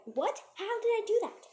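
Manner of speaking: high, incredulous comeback; acted indignant protest. Mean girl denying she cut the hair, acted indignant protest. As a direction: protest in put-on disbelief.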